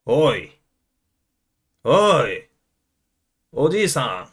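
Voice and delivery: lightly voiced Japanese gangster; short gangster calls with a punchy attack. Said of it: "yes, gangster is good".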